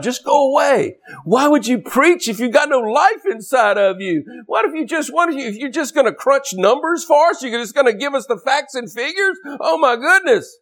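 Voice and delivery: high-pitched, exasperated pulpit tirade; indignant preaching. Real, not acted. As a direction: rail at the lifeless preachers.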